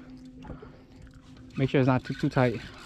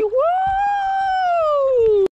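loud high shout; excited woo; 3 dB louder than usual. Real, not acted. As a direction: whoop with joy. Fish landed, cheering.

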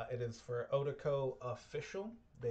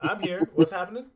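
loud mock startled wake-up; imitating a just-woken dad; 4 semitones higher, 11 dB louder than usual. Dad waking mid-episode, loud and confused. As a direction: pop up confused out of a nap. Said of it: "He's like".

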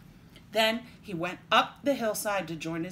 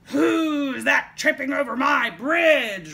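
slow, loud, raised troll roar; 6 semitones higher, 11 dB louder, slower than usual. A narrator's reading. Troll challenging the youngest goat on his bridge, roaring. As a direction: bellow it, stretching the words out. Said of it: "roared the troll".

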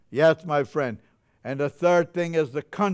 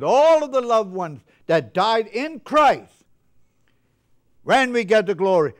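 carried away preaching; 5 semitones higher, 6 dB louder than usual. Real, not acted. Preacher lifting the church about loved ones, louder, higher. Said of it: "I get carried away a little sometimes".

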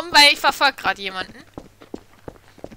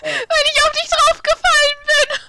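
high laughing, words squeezed out. Real, not acted. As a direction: laugh while explaining. Laughing after accidental kill.

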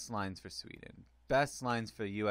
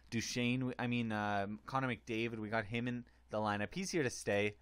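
ordinary delivery

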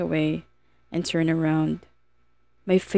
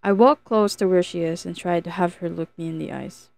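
ordinary delivery

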